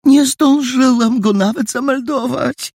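very high, plaintive woman's voice; helpless high-voiced lament; flustered landlady. A narrator's reading. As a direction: make a fretful excuse, pitched high. Flustered landlady excuses herself.

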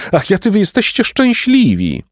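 very high, enraptured outcry; exclaiming. A narrator's reading. Golden lord envying the bread eaters, exclaiming. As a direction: cry it out high with envious wonder. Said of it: "wykrzyknął złoty pan".

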